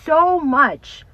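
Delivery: loud, rising preacher's warning; fiery sermon voice; preaching at full force. Real, not acted. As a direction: preach it out to the congregation. Preaching about the enemy.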